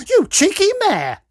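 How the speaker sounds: very high, loud yell; outraged yell. A narrator's reading. Woman insulted back at concert, outraged yell. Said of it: "yelled the woman".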